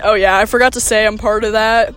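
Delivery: ordinary talk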